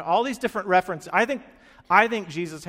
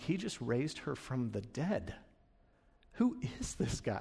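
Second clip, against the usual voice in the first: slow, hushed amazement; low, soft disbelief; awestruck; 4 semitones lower, 9 dB quieter than usual. Acted. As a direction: wonder at it under your voice. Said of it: "Imagine Peter watching that with huge eyes".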